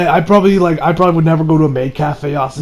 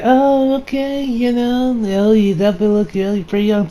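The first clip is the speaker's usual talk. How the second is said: maid voice; 5 semitones higher than usual. Retold or imitated. Maid finding out her client is young, high mock-sweet voice. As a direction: imitate a maid politely backing off, pitched high.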